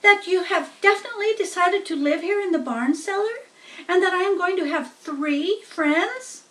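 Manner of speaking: put-on hopeful young pig asking; excited pig. Wilbur asks the spiders if they will stay, high and eager.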